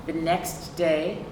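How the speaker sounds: plain reading voice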